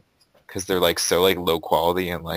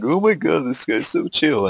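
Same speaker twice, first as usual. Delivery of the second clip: gushing fans; 9 semitones higher, 5 dB louder than usual. Retold or imitated. Mocking a stoner storyteller's fans, high gushing imitation. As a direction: imitate impressed fans, pitch jumping up. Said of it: "So everyone's like".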